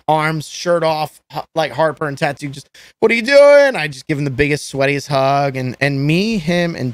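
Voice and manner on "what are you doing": high, loud delighted yell across the room; excited shout. Retold or imitated. Guy spotting his shirtless friend at a party, excited shout. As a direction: shout it like you just spotted your buddy.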